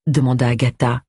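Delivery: ordinary delivery, nothing marked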